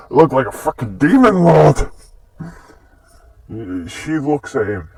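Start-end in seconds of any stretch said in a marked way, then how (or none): 0.1-1.9 mock exclamation. Exclaims as the character, after a laugh.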